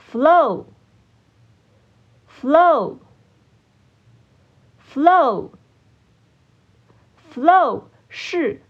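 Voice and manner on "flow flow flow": flow said slowly, long pause after each; repeat-after-me drill. Shown as a demonstration. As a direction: model the word flow for learners to echo. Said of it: "请大家跟我一起念三次". (跟读示范，一词一顿)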